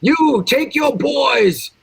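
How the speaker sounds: high, slow booming orders; commanding shout. Acted. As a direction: roar commands at your servant. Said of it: "I'm gonna tell him".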